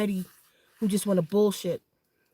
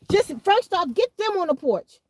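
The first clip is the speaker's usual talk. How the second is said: raised, higher telling-off; firm order; 10 semitones higher, 4 dB louder than usual. Real, not acted. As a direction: bark the order across the room. Ordering family about the kids.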